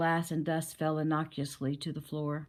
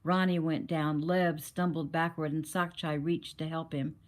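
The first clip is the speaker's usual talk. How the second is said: ordinary delivery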